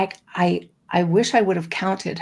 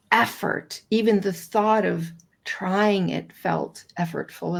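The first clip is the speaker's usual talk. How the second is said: ordinary talk, nothing marked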